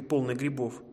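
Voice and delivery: plain reading voice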